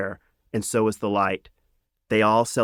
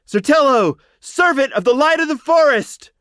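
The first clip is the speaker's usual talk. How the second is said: crowd cheer; 11 dB louder than usual. A narrator's reading. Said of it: "the host cheered as one".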